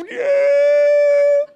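long, loud, high drawn-out noise; slow-motion punch noise. Retold or imitated. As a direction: imitate a huge punch crawling through the air. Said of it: "was about at this speed".

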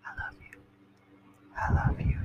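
soft unvoiced whisper. Shown as a demonstration. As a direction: breathe the words softly. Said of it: "give you some A S M R".

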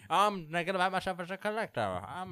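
loud, high squawky Penguin voice; Penguin impression. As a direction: do the Arkham Penguin. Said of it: "like the fucking penguin from Arkham City".

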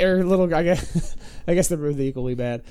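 usual speaking voice

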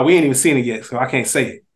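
usual speaking voice

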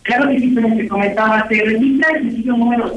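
hollow echoing phone audio; talking far from the handset; phone held away. Real, not acted. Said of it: "se escucha súper hueco".

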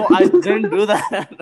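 slow, loud protest through laughter; laughing denial. Real, not acted. [name] denying the accusation while laughing. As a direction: deny it while cracking up.

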